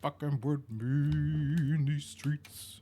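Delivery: high, slow thug swagger; tough gangster voice. Pretend. Threatening the rocks, a slow mock-gangster swagger. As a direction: drawl it out like a street tough.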